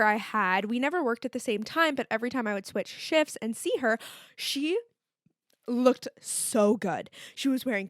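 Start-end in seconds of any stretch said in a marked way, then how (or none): 4.4-7.1 drawn-out admiration. Real, not acted. Admiring coworker's outfit.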